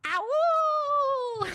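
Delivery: loud high howling ow; mock howl. Narrator mimics the babies howling, loud and high. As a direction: howl it out like the monster.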